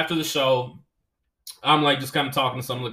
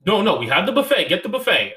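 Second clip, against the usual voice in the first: higher, louder insistent voice; pushy restaurant owner; 6 semitones higher, 5 dB louder than usual. Retold or imitated. Owner insists the comic take the buffet, higher and louder. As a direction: hustle him toward the buffet.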